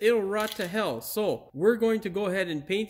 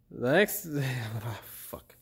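ordinary delivery

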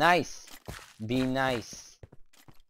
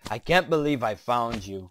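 normal talking voice

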